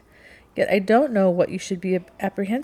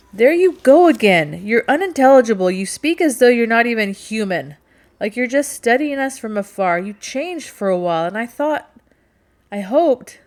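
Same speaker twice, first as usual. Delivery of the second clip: raised, higher voice easing towards the end; exasperated outburst; 5 semitones higher, 6 dB louder than usual. A narrator's reading. Captain berates Rachel as unintelligible, exasperated outburst. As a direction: burst out in frustration, then falter. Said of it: "he regretted his outburst".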